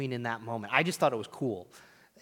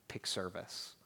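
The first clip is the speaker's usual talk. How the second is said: soft command; 6 semitones lower, 11 dB quieter, slower than usual. Acted. Voicing Jesus' command softly.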